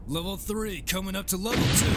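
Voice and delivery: high, loud call of the floor levels. Acted. Pilot calling out levels to the crew, high loud shout.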